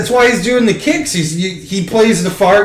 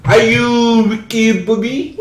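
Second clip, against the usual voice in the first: put-on slow, drawn-out comic French accent; hammed-up French accent; mock French accent; 2 semitones higher, slower than usual. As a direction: do Sacha Baron Cohen's movie Frenchman, slowly. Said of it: "that's how I do my French accent".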